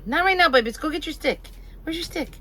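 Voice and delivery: high sing-song dog talk. Real, not acted. Talking to the dog Jax.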